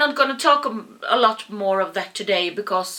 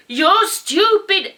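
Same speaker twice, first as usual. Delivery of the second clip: high, loud, slow furious shout; angry yell; 9 semitones higher, 7 dB louder, slower than usual. Retold or imitated. Yelling at the old man in the road, furious. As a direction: spit it out in a rage. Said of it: "And I just yelled at him".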